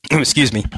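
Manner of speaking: clearing a scratchy throat; real throat clearing, then excuse me. Real, not acted. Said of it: "I had a frog in my throat".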